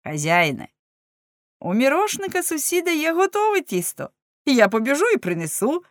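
eager, lightly lifted voice; lively offer. A narrator's reading. Servant offers to fetch dough from the neighbour, lively. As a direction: offer help briskly and eagerly. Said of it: "жваво запропонував Леншпігель".

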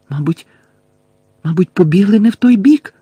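hesitant start, then loud, high rise; falter, then burst out in alarm; horrified alarm. A narrator's reading. Hermione fearing the others ran the wrong way, horrified, voiced outburst. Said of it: "з жахом прошепотіла Герміона".

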